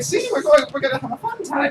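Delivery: high-pitched mock coaxing voice; playful coaxing. Player dragging the skeleton along to have fun, high and coaxing. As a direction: coax in a put-on high voice.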